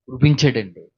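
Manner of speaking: normal talking voice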